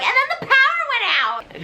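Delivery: put-on high girly gasp; mock shocked girls. Bullies react to lights going out, high. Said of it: "they're like".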